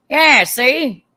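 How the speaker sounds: lightly voiced old movie wise guy; old-timey movie driver. Wife mocking movie driving, lightly put-on old-timey voice. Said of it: "in the old-timey movies".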